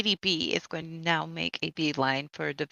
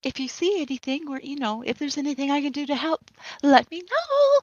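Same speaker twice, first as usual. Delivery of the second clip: Lady Blossom voice; 6 semitones higher, 3 dB louder than usual. Acted. In-character as Lady B.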